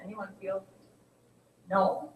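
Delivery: ordinary talk, nothing marked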